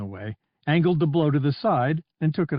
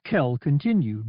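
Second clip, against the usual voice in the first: ordinary delivery, nothing marked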